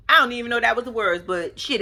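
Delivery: ordinary talk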